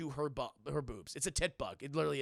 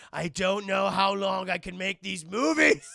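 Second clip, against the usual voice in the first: mock weary outburst; 10 dB louder than usual. Joke end of [name]'s message.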